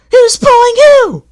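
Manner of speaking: very high, loud taunting coach; mocking coaches. A narrator's reading. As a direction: gloat at Toby. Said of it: "they chattered".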